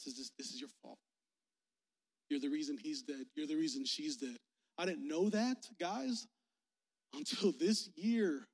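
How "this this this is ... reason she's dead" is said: low, soft, grave accusation; hushed blame. Acted. Grandmother telling mom she caused the deaths, low and quiet. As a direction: repeat the charge quietly, pitched down.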